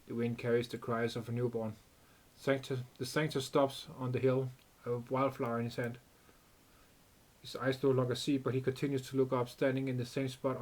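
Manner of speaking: even, soft reading of the game's story text; story narration. A narrator's reading. Streamer reading game story to viewers, calm narration. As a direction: read the scene out calmly, a little hushed.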